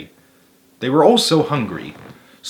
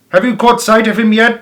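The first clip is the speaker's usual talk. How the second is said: higher, louder call across; 9 semitones higher, 5 dB louder than usual. A narrator's reading. Bean calls back to Boggis, higher and louder. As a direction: shout over the machines. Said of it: "Bean called back".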